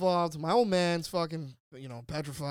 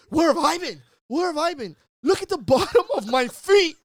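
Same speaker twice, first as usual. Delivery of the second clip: indignant shout; 10 semitones higher, 13 dB louder than usual. Retold or imitated. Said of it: "He's like".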